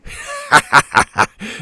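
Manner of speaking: loud, high pirate laugh; big staccato captain's laugh; villain laugh. A narrator's reading. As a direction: laugh in gloating triumph. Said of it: "The captain laughed".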